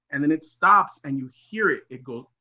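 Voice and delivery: ordinary delivery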